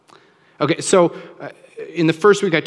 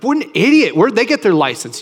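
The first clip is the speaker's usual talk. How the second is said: mock road rage; 5 semitones higher, 5 dB louder than usual. Mocking angry driver in car.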